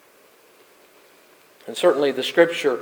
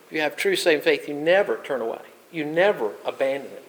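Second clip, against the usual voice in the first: normal talking voice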